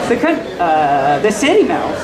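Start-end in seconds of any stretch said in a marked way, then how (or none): none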